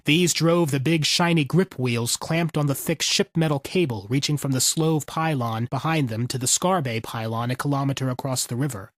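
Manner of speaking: plain reading voice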